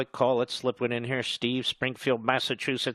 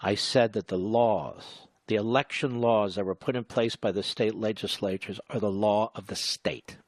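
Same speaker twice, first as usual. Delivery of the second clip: slowed way down; 3 semitones lower than usual. Real, not acted. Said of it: "I'm talking slowly".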